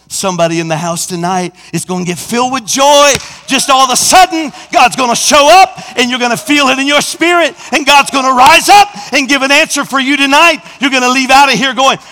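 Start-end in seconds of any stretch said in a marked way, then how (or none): 3.5-12.0 loud, high, building preacher's cry; full-force preaching. Acted. Preacher lifting the church, loud and high. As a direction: preach it hard to the congregation.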